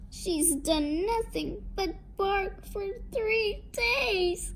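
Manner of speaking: high, slow, plaintive complaint; distraught lament. A narrator's reading. Mother tells of her daughter's barking, distraught lament. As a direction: lament it slowly, pitch staying high.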